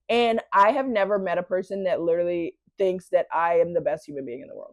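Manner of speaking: ordinary delivery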